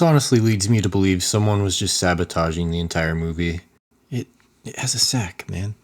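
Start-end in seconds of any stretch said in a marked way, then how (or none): 4.1-5.7 hushed whisper. Real, not acted. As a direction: whisper it like a secret. Aside about the silly prop.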